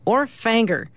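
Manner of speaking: FANGER with an A-like vowel for finger; hillbilly pronunciation. Acted. [name] showing how hillbillies say finger, FANGER with changed vowel. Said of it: "hillbillies say finger".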